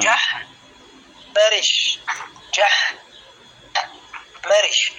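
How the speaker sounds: "جح مرش" broken after "جح", with a clear pause; syllable-by-syllable demo. Shown as a demonstration. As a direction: model "جح مرش" in two separate chunks. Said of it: "ليست الطريقة التي نطقتها بها".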